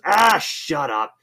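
retold gruff brush-off. Embarrassed guy retorts to teasing.

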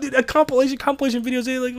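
high-pitched, delighted protest; amused correcting. Real, not acted. Correcting friend amid delighted laughter.

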